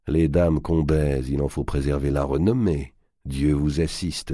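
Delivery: ordinary delivery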